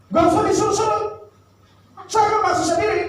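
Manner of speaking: high, huffy boss voice; irritated boss. Acted. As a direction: brush off the driver in a high voice. (bos kesal dengan suara tinggi)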